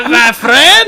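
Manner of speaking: loud, high cartoon Scottish brogue; Scrooge McDuck. Host doing the cartoon duck's voice, loud Scottish impression. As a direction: roll it out big, rising high. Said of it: "the voice of Scrooge McDuck".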